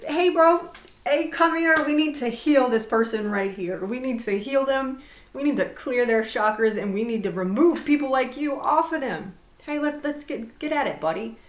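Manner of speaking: loud, hammed-up buddy voice calling a pal over; mock demon bro. Calling a demon buddy over to heal someone, loud mock bro voice. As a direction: play a pushy bro summoning his demon pal, hammed up.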